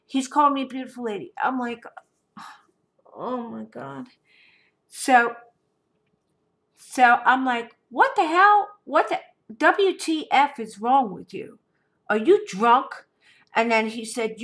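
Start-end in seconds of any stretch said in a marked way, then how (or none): 3.1-4.1 much softer, breathy oh my God; exasperated aside. Real, not acted. Muttering to herself about the ex's texts, exasperated. 7.9-13.1 outraged retort. Retold or imitated. Retelling her outraged reply to the ex, indignant.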